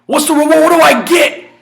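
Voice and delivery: climbing emphatic questions; rising questions. Acted. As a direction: push each question up in pitch. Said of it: "You have gotta ask".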